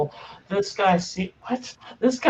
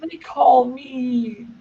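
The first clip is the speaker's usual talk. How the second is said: creature voice; 10 semitones higher, 3 dB louder, much slower than usual. Acted. GM voicing Gribblegast.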